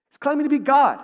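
high, loud scandalized accusation; acted outrage.

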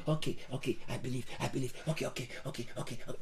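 low, very soft repeated murmuring; hushed, low mimicry of a would-be believer; mock self-persuasion. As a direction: imitate someone quietly talking themselves into it. Mimics someone talking themselves into believing.

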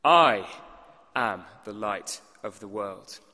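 "I" punched loud and alone, then a pause; stress on I. Shown as a demonstration. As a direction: hit "I" hard, let "am the light of the world" drop. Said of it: "greatest and most important emphasis is on that first word".

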